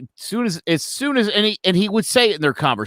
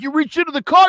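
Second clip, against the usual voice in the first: higher-pitched defensive retort; 8 semitones higher, faster than usual. Retold or imitated. Officer to the man he shot, defensive. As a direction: imitate a panicked self-justification.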